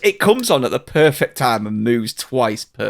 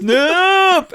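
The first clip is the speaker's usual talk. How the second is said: high-pitched mock child's cry; imitated kid; 3 dB louder than usual. Imitating the kids at the Willy Wonka event, mock child's cry. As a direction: squeal it like a kid, hammed-up. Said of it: "it's the kids".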